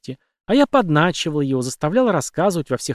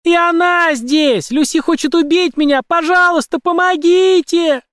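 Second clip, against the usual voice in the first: much higher, louder, slow drawn-out cries; desperate screaming; 6 dB louder than usual. A narrator's reading. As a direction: scream for help at full lungs. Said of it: "закричала Мэгги во всю силу лёгких".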